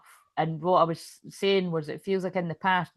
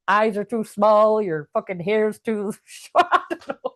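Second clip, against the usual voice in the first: higher, laughing through the words; cracking up; 4 semitones higher, 5 dB louder, slower than usual. Real, not acted. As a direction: lose it to real laughter as you talk. Cracks up listing body flaws.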